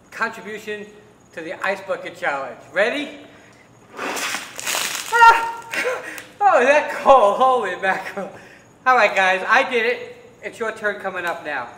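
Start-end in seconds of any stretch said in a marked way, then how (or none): 4.0-5.5 sharp high shriek of cold shock; ice cold scream. Real, not acted. Ice water poured over him. 5.6-6.3 high breathless laugh; shocked laugh. Real, not acted. Laughs right after the dousing. 6.4-8.3 loud gasping exclaim from the cold; in ice cold water. Real, not acted. Just doused in ice water.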